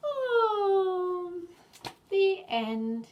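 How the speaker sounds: high, loud gasp of surprise; high surprised oh. Acted. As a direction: open with a delighted gasp. Gasp at the story's end.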